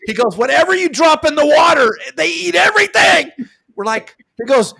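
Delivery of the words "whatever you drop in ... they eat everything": loud, much higher excited shout; retold shout. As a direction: yell it like calling across the water. Retold skipper shouting over radio.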